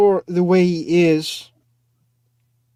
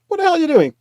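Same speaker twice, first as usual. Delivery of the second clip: mock outrage; 12 semitones higher, 5 dB louder, much faster than usual. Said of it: "I thought".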